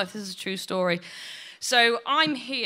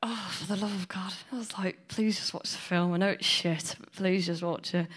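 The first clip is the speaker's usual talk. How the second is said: softer whispered begging; whispered plea; 2 semitones lower, 6 dB quieter than usual. Retold or imitated. Son pleading with mum during film, whispered. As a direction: whisper it desperately in the cinema. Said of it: "I said to her".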